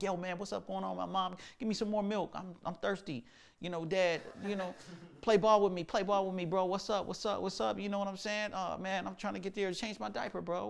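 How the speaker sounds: put-on talking baby. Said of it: "like as a baby, you don't start talking like".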